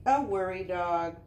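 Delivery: ordinary delivery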